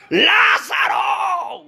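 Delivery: long, high, drawn-out cry; full-voiced preacher's shout soaring upward; shouting as Jesus. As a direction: call the dead man out, stretching the name. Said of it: "se extendió y gritó".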